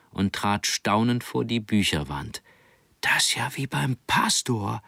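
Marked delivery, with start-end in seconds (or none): 3.0-4.8 whispering in amazement; awed whisper. Acted. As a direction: breathe it out in awe. Boy amazed at bookshelf.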